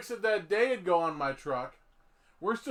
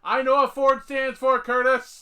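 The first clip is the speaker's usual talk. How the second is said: loud, higher-pitched outburst; exasperated shout; 6 semitones higher, 12 dB louder than usual. Real, not acted. Host shuts down Curtis on Ford jokes, exasperated shout. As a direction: shout back at a know-it-all.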